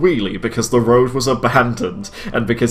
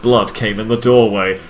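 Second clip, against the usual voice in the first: ordinary talk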